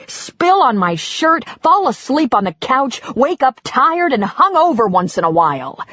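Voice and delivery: husband ranting about his wife's rules. Acted. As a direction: let it all boil over. Husband continuing rant.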